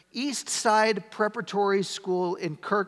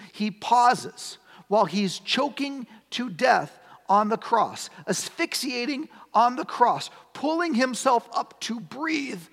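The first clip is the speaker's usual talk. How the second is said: impassioned preaching; 6 semitones higher, 3 dB louder than usual. Acted. Preacher builds on crucifixion.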